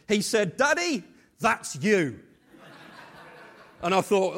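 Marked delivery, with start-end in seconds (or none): 0.6-2.2 a lightly voiced child, slow and proud; proudly presenting his drawing to Daddy; little boy. Son presents the picture.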